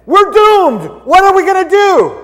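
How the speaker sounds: very high, loud, panicked cry; hammed-up terror, way up high and loud; panicked exclaim. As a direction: shriek it like all is lost.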